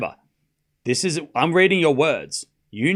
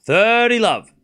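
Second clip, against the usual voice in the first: higher, drawn-out THIRTY, falling on love; umpire-style score call; 7 semitones higher, 4 dB louder, slower than usual. Acted. Announcing the score to viewers, umpire-style call.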